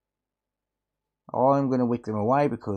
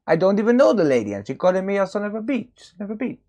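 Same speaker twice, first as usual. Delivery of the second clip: indignant; 8 semitones higher, faster than usual. Retold or imitated. Punchline of accented toilet joke.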